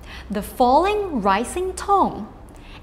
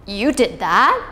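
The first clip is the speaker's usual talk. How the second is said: dips low, then scoops up in disbelief; incredulous; 3 semitones lower, 4 dB louder than usual. Shown as a demonstration. Teacher likening third tone to reacting to a friend, incredulous question. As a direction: question it in louder, swooping disbelief. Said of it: "which you never thought they would do".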